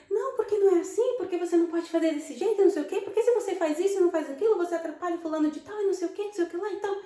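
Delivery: high, fast angry lecturing. Retold or imitated. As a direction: rattle off an irate telling-off. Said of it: "E ela tava a falar alto e muito brava". (bronca rápida, alta e irritada)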